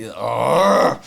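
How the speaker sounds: short angry grunt; frustrated growl. Retold or imitated. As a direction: let out a frustrated argh. Frustrated outburst.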